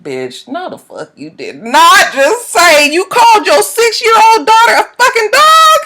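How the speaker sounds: high, slowed-down outraged squeal at the end; outraged retort. Retold or imitated. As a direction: scold her in shocked disbelief. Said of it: "And I, I said".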